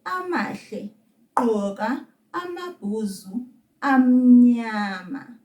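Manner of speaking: slow phrase with gaps to copy; slow demonstration. Shown as a demonstration. Teacher has learner repeat after her, slowly. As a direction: stretch the words, pausing between each. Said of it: "Let's say that slowly".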